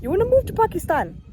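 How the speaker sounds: high, loud disbelieving question; surprised. Real, not acted. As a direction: gasp it out in shock. Shocked at brother's answer.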